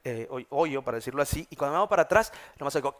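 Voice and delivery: ordinary talk, nothing marked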